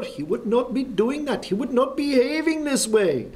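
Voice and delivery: put-on high, Pakistani-accented outrage; indignant Pakistani accent voiced high. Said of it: "Anyway, he comes up".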